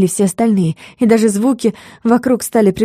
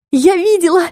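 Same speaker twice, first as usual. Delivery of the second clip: high, elated cry of triumph; joyful exclamation; 10 semitones higher than usual. A narrator's reading. Heroine joyfully telling the others what she saw, excited. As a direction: burst out with delight, pitched high. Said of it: "сказала радостно".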